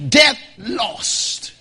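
ordinary talk

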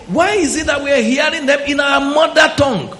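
amazed crowd. Retold or imitated. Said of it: "And the people say".

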